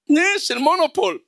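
high, mock letdown at a fake bill; disappointed discovery. Rabbi acting the finder who sees the note is fake, high let-down surprise. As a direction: sigh it out, the bill is Monopoly money.

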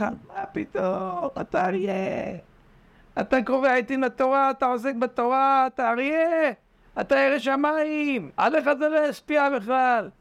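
high, coaxing voice of the evil inclination; flattering tempter. Acted. Evil inclination coaxing the man, high and flattering. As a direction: sweet-talk him into feeling safe.

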